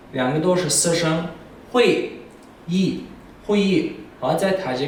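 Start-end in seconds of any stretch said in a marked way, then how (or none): 1.7-3.0 会 and 议 each fall sharply, 会 starts high; syllable by syllable. Shown as a demonstration. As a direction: split 会议 into two falling beats.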